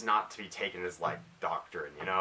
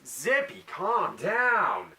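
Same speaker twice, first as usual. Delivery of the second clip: scolding pet; 10 semitones higher, 5 dB louder, much slower than usual. Real, not acted. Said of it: "telling pet to calm down".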